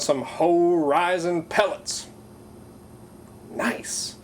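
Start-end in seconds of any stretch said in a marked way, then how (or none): none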